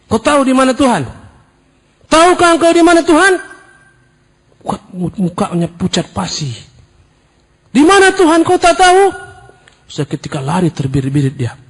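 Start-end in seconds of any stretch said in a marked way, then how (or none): 0.1-3.3 loud, high thundering question; cleric's bellow. Retold or imitated. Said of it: "Dia bentak". 7.7-9.0 loud, high thundering question; cleric's bellow. Retold or imitated. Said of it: "Dia bentak".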